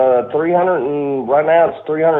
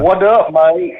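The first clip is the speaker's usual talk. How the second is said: higher-pitched mock British greeting; attempted British accent; 4 semitones higher, 3 dB louder, slower than usual. Co-host jokingly greets an Alabama caller, attempted British accent. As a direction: have a go at a UK accent. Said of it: "Kelly trying to do a U K accent".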